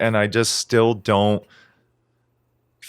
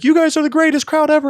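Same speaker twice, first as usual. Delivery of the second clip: very high, hammed-up stage gush; imitating a frontman; 4 dB louder than usual. Podcaster mocks a band's stock crowd praise, very high hammed-up gush. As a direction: oversell it like a singer flattering the crowd.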